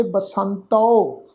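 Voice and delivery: "ਬਸੰਤੋ" slowly, nasal san and final au; ba-san-tau, drawing out the end of "ਬਸੰਤੋ"; nasalised pronunciation. Shown as a demonstration. Teacher showing the nasal sound, slow careful pronunciation. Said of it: "ਟਿੱਪੀ ਦੀ ਮਾਤਰਾ ਨਾਸਕ ਉਚਾਰਨ ਕਰਾਂਗੇ".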